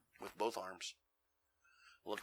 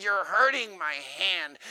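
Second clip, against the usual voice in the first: slow, whiny lady voice; lady complaining to the preacher, slow whine; pained lady; 9 semitones higher, 9 dB louder, much slower than usual. Retold or imitated. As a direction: draw out the complaint. Lady in pain.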